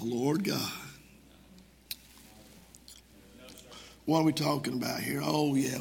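low, soft mock indifference, fading to a mumble; bored, offhand reading. Preacher mocking how Jeremiah did not say it, listlessly. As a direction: let it trail down as if you barely care. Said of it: "I don't believe he said".

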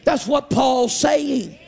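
rising, sung-out preacher cadence; preaching. Acted. Preacher to Wednesday night congregation, fervent preaching voice. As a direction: preach it with full fervor to the church.

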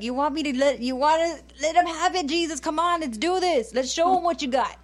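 mock-eager disciple begging for lightning. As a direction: hype Jesus up to strike them down. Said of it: "And it's like".

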